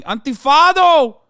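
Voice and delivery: high, loud chant of Antifado; mock protester shout. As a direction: yell it like an angry Brazilian rally crowd. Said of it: "They're saying".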